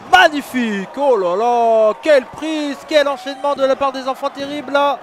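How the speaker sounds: rising excited ringside call; wrestling commentator. Real, not acted. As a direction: call the tag combo live. Live wrestling play-by-play. (commentateur de catch enthousiaste)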